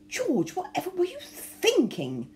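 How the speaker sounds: high, slow exasperated exclamation; a wife aghast at her husband; lady crying out in dismay; shocked cry. A narrator's reading. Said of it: "cried Lord, Lady Hastings".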